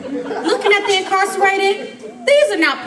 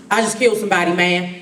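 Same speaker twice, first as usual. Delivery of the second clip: mock low killer voice; 10 semitones lower than usual. Voicing killer in story.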